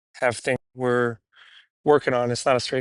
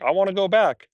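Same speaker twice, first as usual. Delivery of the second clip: voicing the dog; 6 semitones higher than usual. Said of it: "and he's like".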